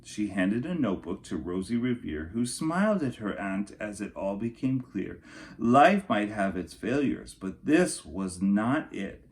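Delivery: storybook narration for kindergartners; lilting picture-book reading voice; reading to children. Acted. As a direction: read it like story time for little kids. Reads picture book to class.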